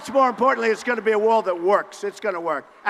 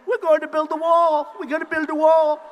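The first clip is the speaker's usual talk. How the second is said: lightly parroting a rival politician, higher and louder; mocking Marco Rubio; 6 semitones higher, 5 dB louder than usual. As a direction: echo his slogan like an eager copycat. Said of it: "little Marco, he said".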